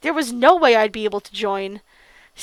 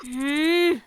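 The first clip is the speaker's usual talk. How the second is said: ordinary delivery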